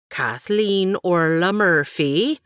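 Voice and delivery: slow, stern, loud full-name call; the warning a parent gives with the full name. Acted. Aunt scolds Katie by her full name, slow and stern.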